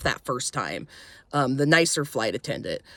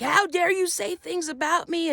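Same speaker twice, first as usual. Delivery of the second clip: mock scream; 12 semitones higher than usual. Attendant screaming at passenger who complained, mock scream. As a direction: imitate a screaming rant without full volume. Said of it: "he's screaming at me".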